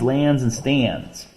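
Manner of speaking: slow, leaning on each rhyme word; slow, stressed rhymes; stressing the rhymes. Shown as a demonstration. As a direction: draw out the rhyming words. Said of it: "ended with hands".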